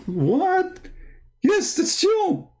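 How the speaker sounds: very high, astonished imitation; drawn-out, high-pitched mock amazement; excited fan. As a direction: squeal it like a fan who recognizes his idol. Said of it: "Why you are excited".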